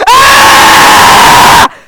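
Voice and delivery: deafening "AH" scream into the mic; full-volume yell. Shown as a demonstration. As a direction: max out the level meter with a yell. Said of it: "I wanna see how, how, uh, high".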